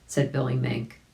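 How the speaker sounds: ordinary delivery, nothing marked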